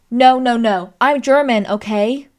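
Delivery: loud, indignant American woman impression; fumbled American accent, insistent and loud; attempted American accent. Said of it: "try and do an American accent".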